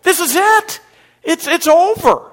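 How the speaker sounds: high, loud panicked cry; acted frantic despair, pitch way up. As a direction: shout it like a frightened crew going under. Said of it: "they're frantic".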